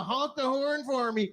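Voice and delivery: high, slow mock cheer; Freedom mom. Freedom mom cheering the truckers, high slow mock voice. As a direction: cheer on the convoy, drawn out. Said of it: "one of these".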